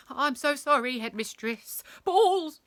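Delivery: high, agitated teacher; apologise, then blurt the last word loudly; helpless outburst after grovelling; flustered apology. A narrator's reading.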